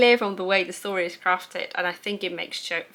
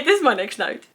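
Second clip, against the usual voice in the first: usual speaking voice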